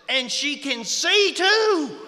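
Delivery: very slow, high, booming disbelief; shouted amazement. Retold or imitated. Friend marvels to his own wife about the newcomer, loud drawn-out astonishment. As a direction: holler it in mock astonishment, stretching every word.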